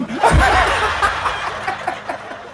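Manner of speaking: laughing high at the punchline. Real, not acted. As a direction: burst out laughing. (tertawa tinggi setelah lelucon)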